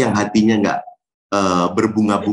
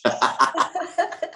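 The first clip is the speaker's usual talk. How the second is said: high laugh; 5 dB quieter than usual. Real, not acted. Laughing at crazy rich boast.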